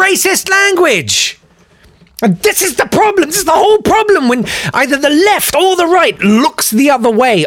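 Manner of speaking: high-pitched, exasperated outburst; indignant rant. Real, not acted. Comic rant about British politics.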